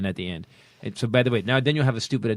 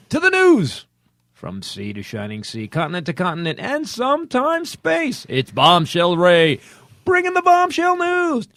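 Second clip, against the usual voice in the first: mock news intro; 5 semitones higher, 6 dB louder than usual. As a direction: ham up a grand anchor opening. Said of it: "That was beautiful".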